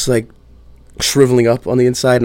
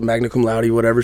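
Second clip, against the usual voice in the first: normal talking voice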